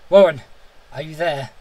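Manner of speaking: loud call on the name. A narrator's reading. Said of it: "Again I called".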